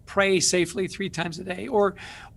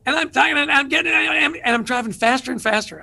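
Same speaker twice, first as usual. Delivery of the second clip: higher and louder, building like he's getting worked up; reliving the drive, revving up as he talks; retold agitation; 6 semitones higher, 3 dB louder than usual. Telling the host about the freeway call, mock worked-up. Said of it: "I am getting really worked up".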